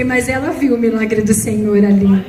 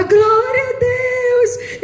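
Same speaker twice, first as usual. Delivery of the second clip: high, drawn-out exultant shout; praising God; 10 semitones higher, much slower than usual. Retold or imitated. Mother praising God as her baby revives, high and drawn out. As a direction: stretch out the praise in joy. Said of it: "e eu falava".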